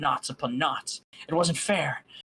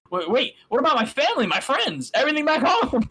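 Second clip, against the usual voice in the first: high, loud, rushed worry; sudden alarm, voice jumping higher and louder; alarmed question; 11 semitones higher, 6 dB louder than usual. Acted. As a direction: blurt out the sudden fear. Sudden alarm over family.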